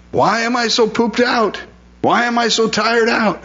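pitched far up, a put-on whine about being worn out; high whiny complaint. Pastor mimicking himself complaining to God, high and whiny. Quoting his own weary complaint.

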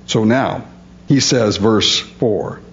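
ordinary talk, nothing marked

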